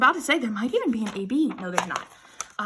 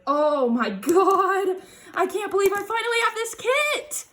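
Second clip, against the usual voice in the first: excited disbelief; 7 semitones higher than usual. Real, not acted. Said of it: "I'm so excited".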